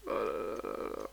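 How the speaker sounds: oh là là soufflé, grave; désespoir joué. Pretend. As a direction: lamentation moqueuse. Pluie dangereuse. (lamentation exagérée)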